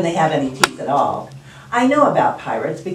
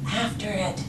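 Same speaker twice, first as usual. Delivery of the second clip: very soft, hushed echo; whispering crew; 10 dB quieter than usual. Acted. Crew echoes captain's order about the ball, whispering. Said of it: "they all whispered".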